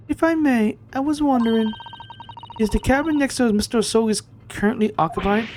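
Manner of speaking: high, tentative questioning voice; timid polite stowaway. Acted. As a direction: ask very politely, pitched right up.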